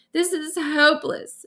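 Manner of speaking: ordinary delivery, nothing marked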